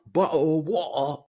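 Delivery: hammed-up British accent with dropped T's. Shown as a demonstration. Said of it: "doing a good British accent".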